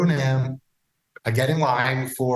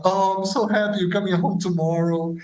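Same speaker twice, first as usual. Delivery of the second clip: higher, sing-song delighted voice; happy wife; 6 semitones higher than usual. Retold or imitated. Wife on the phone, happy her husband returns, imitated. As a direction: imitate a wife thrilled he's coming home. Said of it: "she's like".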